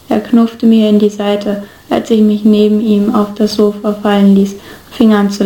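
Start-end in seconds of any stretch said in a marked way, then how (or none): none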